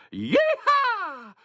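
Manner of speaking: a high whooping yeehaw, cowboy style. Pretend. Host whoops for [name]'s Cowboy Carter with a high yeehaw. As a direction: holler it like a rodeo cowboy.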